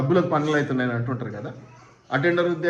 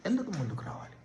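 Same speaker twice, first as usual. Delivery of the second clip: softer, almost hushed echo of the question; hushed repeat; 4 semitones higher, 11 dB quieter than usual. Shown as a demonstration. Preacher to congregation, a hushed rhetorical echo. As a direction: drop the question to a near hush. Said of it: "దీవించటానికి ఎందుకు ముందుకు రావాలి?".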